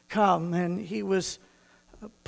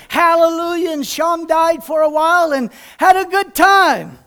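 joyful praise; 5 semitones higher, 5 dB louder than usual. Real, not acted. Rejoicing after jig.

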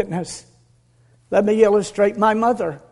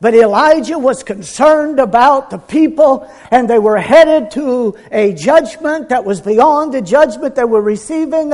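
ordinary talk, nothing marked

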